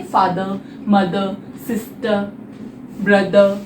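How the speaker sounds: फादर, मदर, सिस्टर, ब्रदर each ending in a schwa; silent final R. Shown as a demonstration. Teacher giving examples of the schwa sound, silent final R. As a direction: list them slowly, every final R dropped to "uh". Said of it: "आर silent है".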